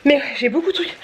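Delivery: out of breath from blowing up balloons; breathless, short of air; gasping for air. Real, not acted. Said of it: "j'ai plus de souffle". (essoufflée)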